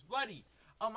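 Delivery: ordinary talk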